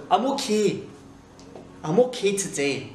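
Scottish OK with no ay glide; demonstrated Scottish ok; Scottish accent. Shown as a demonstration. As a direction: say ok nearer okee, as a Scot. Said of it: "En écossais".

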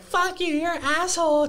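put-on high whiny drunk-girl voice; imitated drunk girl. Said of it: "'cause she's all drunk".

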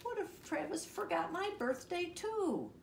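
high-pitched fretful wail; worried cry. A narrator's reading. Froggy fears Travis forgot too, high worried cry. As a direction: fret it out way up high. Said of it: "cried Froggy".

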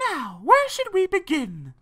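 high spider voice; high-pitched creature nanny. A narrator's reading. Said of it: "said the nanny".

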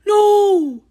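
high, loud refusal; shout. A narrator's reading. Toad refusing Frog's plan, shouting. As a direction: shout it high-pitched in protest. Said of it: "shouted Toad".

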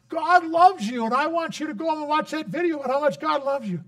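put-on high, loud, earnest child's voice; little girl. Girl telling the elderly person God loves them, high and earnest. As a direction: tell it like an eager kid spreading good news.

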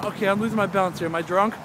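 ordinary talk, nothing marked

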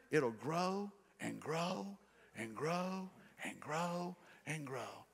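dropping to a slow, hushed grow and grow; slow fading warning. Acted. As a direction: let each grow fade away like a warning. Warning sin keeps growing.

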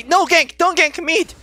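imitating a teammate's high-pitched protest; mock urgent refusal. Streamer mimicking his mid laner refusing ganks, mock urgent.

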